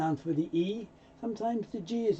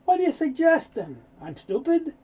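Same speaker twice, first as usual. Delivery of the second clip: high, loud indignant challenge; offended; 10 semitones higher, 6 dB louder than usual. Acted. Man insulted by a suggestion that he's stupid, indignant. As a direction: fire it back as an insulted person. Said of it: "they're offended".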